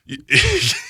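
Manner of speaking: short very high squeal of laughter; squeaky laugh. Real, not acted. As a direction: let out a squeaky giggle. Laughing at ski mask plan.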